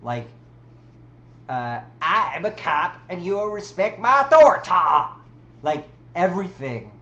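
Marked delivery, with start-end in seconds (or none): 2.0-4.7 put-on higher, louder bossy kid demand; Cartman. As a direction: bark it like a bratty kid cop. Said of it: "something Cartman would do".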